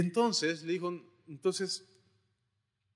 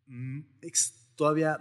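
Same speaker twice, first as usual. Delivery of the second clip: ordinary talk, nothing marked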